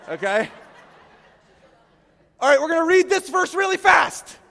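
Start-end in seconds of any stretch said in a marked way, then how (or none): 2.4-4.4 loud, high call to the crowd; rally the room; preacher shouting to the congregation. Real, not acted.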